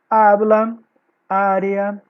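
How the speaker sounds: "habla, área" with loud opening A's; "habla, área" as separate words, stressed at the start; stressed first syllable. Shown as a demonstration. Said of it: "é a tônica".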